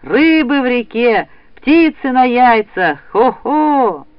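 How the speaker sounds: slow, drawn-out cry, high and loud; loud ringing call. A narrator's reading. Boy rousing the whole house, loud drawn-out call. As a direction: send it rolling through the whole house. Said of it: "раскатился его громкий голос".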